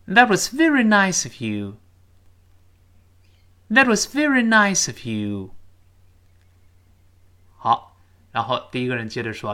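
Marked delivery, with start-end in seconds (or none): 0.1-5.6 quicker, louder, higher read; faster reading. Shown as a demonstration. Teacher speeding up the sentence for pupils to follow. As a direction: speed it up at natural pace. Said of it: "我们读快点".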